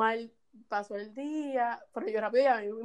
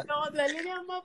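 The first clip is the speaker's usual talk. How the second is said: ordinary talk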